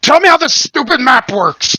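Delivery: high, strained half-orc bellow; old grizzled half-orc. Acted. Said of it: "an old grizzled half orc going".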